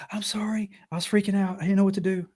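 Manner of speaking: high, pleading excuse; mock panicked apology. Biter apologizes to the man she bit, mock panic. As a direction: imitate her frantic apologizing.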